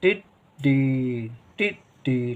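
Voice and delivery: टिड्डी very slowly, short टि, pause, long डी; a brief टि, then a stretched डी. Shown as a demonstration. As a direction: break टिड्डी into two separated syllables. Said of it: "कम देर के लिए छोटी की मात्रा".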